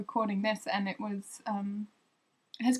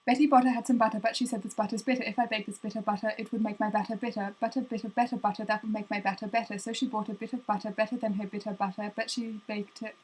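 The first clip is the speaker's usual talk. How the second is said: rushed Betty Botter in a single breath; fast, one breath; faster than usual. Shown as a demonstration. Racing through Betty Botter in one breath for viewers. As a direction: rattle it off without stopping. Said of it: "one breath and see if I can get it really fast".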